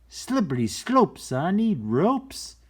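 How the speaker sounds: high loud storybook voice; excited. Acted. Goat rhyme read high.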